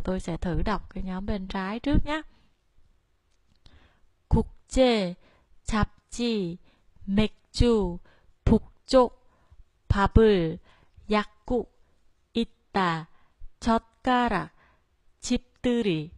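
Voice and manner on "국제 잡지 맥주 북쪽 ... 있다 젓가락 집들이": 국제, 잡지, 맥주 each begun low and falling; low falling start. Shown as a demonstration. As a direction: start every word like 국제 low and let it drop. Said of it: "Nhớ là tất cả đều xuống giọng nhé".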